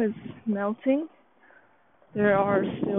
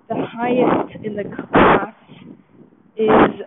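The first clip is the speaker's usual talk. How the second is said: excited; 3 semitones higher, 9 dB louder, slower than usual. Real, not acted. Reporting top score.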